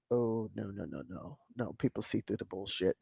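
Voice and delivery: dropped to a near-murmur, dismissive; scoffing no. Real, not acted. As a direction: brush it off under your breath. Scoffing at the media's claim.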